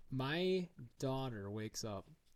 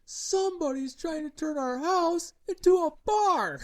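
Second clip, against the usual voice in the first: put-on high, loud outraged child's complaint; a little girl tattling in full alarm; indignant daughter; 12 dB louder than usual. Daughter complains about the bar decor.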